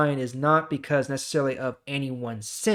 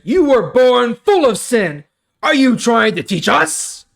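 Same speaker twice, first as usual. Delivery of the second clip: angry leaders; 9 semitones higher, 10 dB louder than usual. Acted. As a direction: scold him from above, high and loud.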